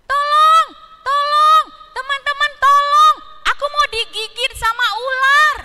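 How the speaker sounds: high, loud fake cry for help; parrot crying for help. Parrot fakes a call for help to its friends, high loud shout. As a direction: shriek for help like a tricky parrot. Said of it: "tahu nggak dia bilang apa".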